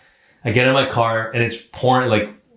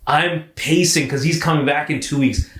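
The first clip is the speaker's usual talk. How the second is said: laughing; 7 semitones higher than usual. Real, not acted. Laughing retelling the dread.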